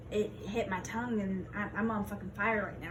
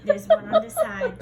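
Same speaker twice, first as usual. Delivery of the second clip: laughing; 8 dB louder than usual. Real, not acted. Laughing while counting seeds.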